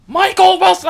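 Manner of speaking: high, loud scolding shout; a teacher shouting a student's name; teacher's yell. Retold or imitated. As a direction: bellow the full name like an angry teacher. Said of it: "they're like".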